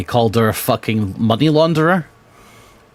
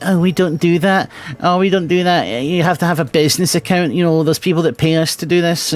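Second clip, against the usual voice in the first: higher, prim mock voice of a post office clerk; snooty clerk impression; 7 semitones higher than usual. Son mocks the post office clerk who refused his mother, higher mock voice. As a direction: mimic the clerk turning the mother away, higher and prissy. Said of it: "And they're like".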